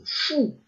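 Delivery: soft, airy "shoo" whoosh; imitated whoosh. Shown as a demonstration. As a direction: voice a quick swoosh of super speed. Imitates sudden super-fast movement. (bruitage de vitesse, « chou »)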